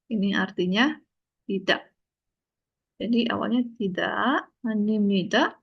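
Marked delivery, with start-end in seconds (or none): none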